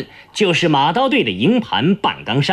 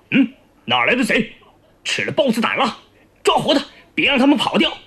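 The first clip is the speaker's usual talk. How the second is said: soldiers yelling; 5 semitones higher, faster than usual. Acted. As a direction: cry out the order in a higher, hurried rush by the end. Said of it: "嚷着围过来". (士兵叫嚷)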